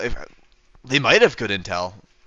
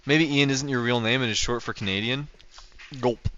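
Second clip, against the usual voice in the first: ordinary talk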